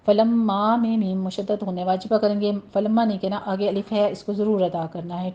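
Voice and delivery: ordinary talk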